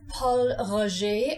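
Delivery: Paul Roger said slowly, Roger as ro-zhay; French Pol Roger. Shown as a demonstration. Teacher modelling Paul Roger, slow and French. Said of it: "Don't say Roger like the man's name Roger".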